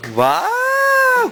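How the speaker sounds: very high, drawn-out wow; excited squeal. Real, not acted. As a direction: squeal a long, delighted wow. Excited reaction to child's Darna act.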